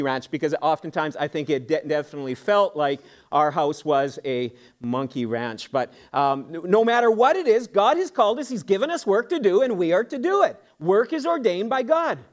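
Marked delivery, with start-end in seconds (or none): none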